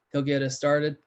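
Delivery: ordinary delivery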